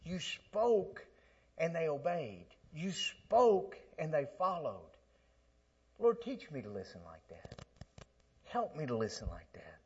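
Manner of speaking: soft, earnest retold prayer; a hint of a whispered plea; prayer to the Lord. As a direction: pray it quietly, sincerely. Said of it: "I said".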